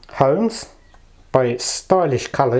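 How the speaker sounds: normal talking voice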